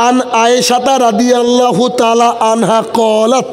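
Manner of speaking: slow, each word set apart; staccato. Shown as a demonstration. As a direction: recite it piece by piece, slowly. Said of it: "কেটে কেটে বলছি". (কেটে কেটে ধীরে ধীরে বলা)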